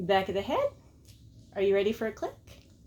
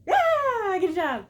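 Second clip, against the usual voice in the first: much higher, louder cheer; dog praise; 10 semitones higher, 4 dB louder, much slower than usual. Pretend. Praising dog after click.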